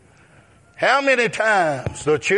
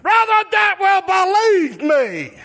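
preaching at full force; 11 semitones higher than usual. Acted. Preacher voicing God's words to the congregation, shouting.

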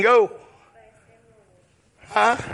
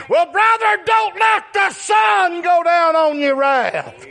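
preaching at full force; 10 semitones higher than usual. Acted. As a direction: hammer the verse home. Preacher shouts the answer.

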